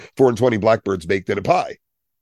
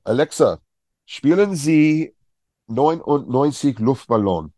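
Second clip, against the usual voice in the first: higher, slow, firm command, spielen Sie carefully spelled out; German command to Alexa; 7 semitones higher than usual. Pretend. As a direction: order the speaker in German, deliberately. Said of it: "I could do it right now".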